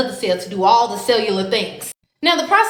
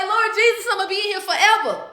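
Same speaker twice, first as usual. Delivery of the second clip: scared; 8 semitones higher than usual. Retold or imitated. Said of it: "So now I'm traumatized and I'm scared".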